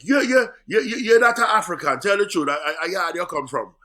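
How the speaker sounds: high, loud, insistent Jamaican voice; excited Jamaican local. Retold or imitated. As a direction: imitate a Jamaican fan refusing to believe it. Acting out excited Jamaican airport staff.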